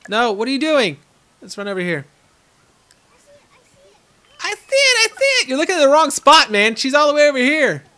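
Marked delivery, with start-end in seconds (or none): 4.4-7.8 much higher, louder excited yelling; excited shout. Real, not acted. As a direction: shout it like you just found her. Spotting the animal excitedly.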